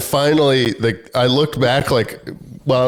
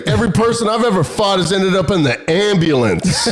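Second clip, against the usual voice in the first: higher-pitched mock tough guy; retold blustering boast; 7 semitones higher than usual. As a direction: imitate a passenger bragging to intimidate. Passenger boasts during threat.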